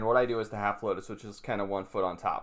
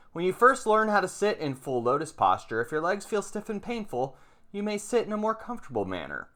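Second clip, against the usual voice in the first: lifted, higher reading voice for the text; reading scripture aloud; 4 semitones higher than usual. Acted. Teacher reading a Chan passage to viewers, raised reading voice. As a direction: read the master's words with care, a bit higher.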